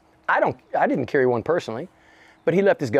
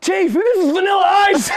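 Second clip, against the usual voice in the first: high, loud mock call to the chief; excited call-out; 5 dB louder than usual. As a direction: shout the news to the boss. Cop calling out to chief.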